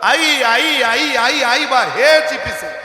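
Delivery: put-on loud, high wailing child calling for his mother; a lost child's desperate crying calls. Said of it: "रडत होतं".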